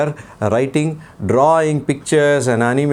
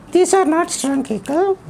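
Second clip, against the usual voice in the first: put-on puppet boy voice; 14 semitones higher than usual. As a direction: play the cheeky little boy puppet. Puppet child voice.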